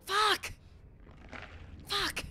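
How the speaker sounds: high frustrated cursing; frustrated yell. Real, not acted. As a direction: curse in sharp frustration.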